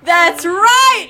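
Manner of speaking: loud, high cheer; excited shout. Real, not acted. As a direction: yell it in triumph. Confirming the right answer.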